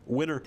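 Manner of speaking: "winter" without its T, like "winner"; dropped T. Shown as a demonstration. Streamer showing Michigan speech, dropped T in "winter". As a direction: swallow the T in "winter" so it becomes "winner". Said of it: "like we're saying winner".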